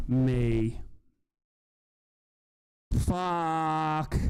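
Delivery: slow, drawn-out, deflated groan of disbelief; stunned despair. Real, not acted. Fan gutted by the news, slow stunned swearing. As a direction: let it sink in, crushed. Reacting to bad match news.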